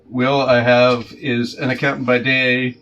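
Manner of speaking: ordinary delivery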